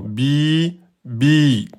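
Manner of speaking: "Bi, bi" each with the i held long; long i in two beats. Shown as a demonstration. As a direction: draw out the i of "bi" twice. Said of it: "deux temps". (« bi » tenu deux temps)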